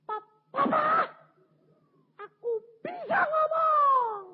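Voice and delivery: slow, very high crying shout; mute wife finding voice. Acted. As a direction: strain each word out, then burst loud. Mute wife finds her voice. (jeritan haru istri bisu)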